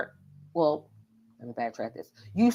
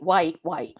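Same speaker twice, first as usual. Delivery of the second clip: ordinary talk, nothing marked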